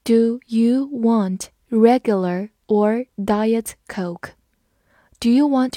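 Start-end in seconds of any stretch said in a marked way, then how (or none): 0.0-4.3 slow, even read-along. Shown as a demonstration. Teacher reading slowly for students to repeat. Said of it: "跟着我慢读一遍".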